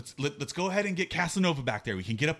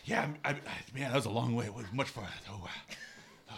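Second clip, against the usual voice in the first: winded, lower and trailing away; out of breath; 4 semitones lower, 6 dB quieter than usual. Acted. Runner tells friend it was a long way, winded. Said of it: "I gotta catch my breath".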